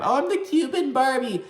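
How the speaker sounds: high-pitched, hammed-up doll-like voice; mocking impression. Host mocks a castmate as Cuban Barbie, derisive impression. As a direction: mimic her in a silly high voice. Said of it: "being a dumbass fucking bitch".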